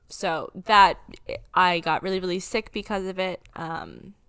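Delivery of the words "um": ordinary talk, nothing marked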